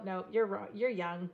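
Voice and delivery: normal talking voice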